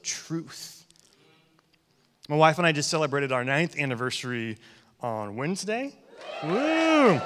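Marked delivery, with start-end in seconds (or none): 6.4-7.2 high, loud "woo" cheer; celebratory whoop. Real, not acted. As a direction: let out a rising whoop of celebration. Cheers own wedding anniversary.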